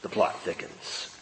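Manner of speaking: whispered, hushed suspense; hushed dramatic aside; conspiratorial whisper. Acted. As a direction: lean in and whisper the intrigue. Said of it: "Oh, this is interesting".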